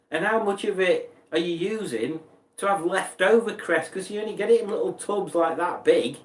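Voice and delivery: usual speaking voice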